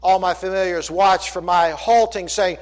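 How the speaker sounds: loud, emphatic pulpit reading; declaimed scripture reading. Acted. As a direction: proclaim the verse to the congregation.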